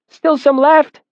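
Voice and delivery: put-on high, loud child voice calling with mouth full. A narrator's reading. Young boy calling to the narrator, high and loud. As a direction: call out like a small boy chewing bread. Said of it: "he called through a mouthful of bread".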